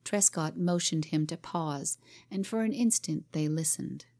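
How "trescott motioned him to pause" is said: ordinary delivery, nothing marked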